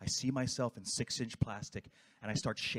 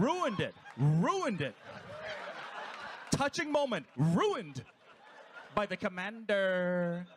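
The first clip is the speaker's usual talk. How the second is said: mock outrage; 8 semitones higher, 4 dB louder, slower than usual. Real, not acted. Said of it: "reacting to the ruined moment".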